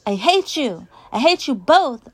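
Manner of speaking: high, loud furious holler; child's all-out angry shout. A narrator's reading. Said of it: "I holler at them".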